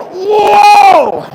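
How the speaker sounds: high, loud startled whoa; startled shout. Retold or imitated. Guest yells as the huge fish jumps, a startled high whoa. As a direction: yelp as the fish leaps.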